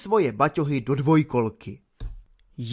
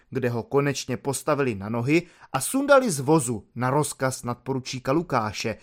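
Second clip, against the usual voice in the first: usual speaking voice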